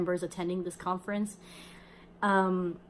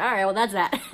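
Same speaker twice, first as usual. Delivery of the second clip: louder, laughing it off; laughing dismissal; 4 semitones higher, 6 dB louder than usual. Retold or imitated. Girl laughs off her first healing, louder. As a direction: shrug it off with a laugh.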